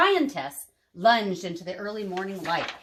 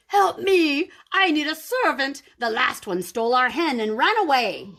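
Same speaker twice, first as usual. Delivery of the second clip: loud, high giantess roar fading toward the end; 5 semitones higher, 4 dB louder than usual. A narrator's reading. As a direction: bellow it out like an angry giantess. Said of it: "she roared".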